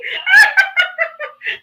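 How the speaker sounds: loud, high laughing fit; high burst of laughter. Real, not acted. Co-host laughs at nearly saying I love you, loud and high. Laughs at her own slip.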